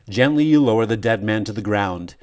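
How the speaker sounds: plain reading voice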